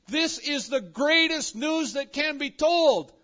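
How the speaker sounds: loud, high and slow, every word hammered; soaring, drawn-out preacher's shout; excited proclamation. Acted. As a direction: proclaim it at full force like thrilling news. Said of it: "He is so excited about this good news".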